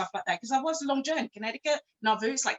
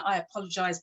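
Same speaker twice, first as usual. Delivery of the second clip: ordinary talk, nothing marked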